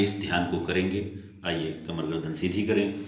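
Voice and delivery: usual speaking voice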